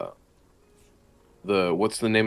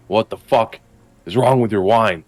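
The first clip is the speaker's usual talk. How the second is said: angry yelling; 3 semitones higher, 4 dB louder than usual. Acted. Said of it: "I just start yelling at him".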